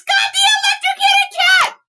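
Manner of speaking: high delighted squeal; excited shriek. Real, not acted. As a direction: squeal it with pure joy. Recognizing movie reference, overjoyed.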